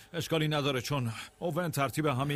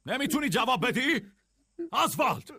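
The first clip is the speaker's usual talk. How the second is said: high, raised-voice demand; impatient calling; 12 semitones higher than usual. Acted. Demanding Oswald answer, impatient high call.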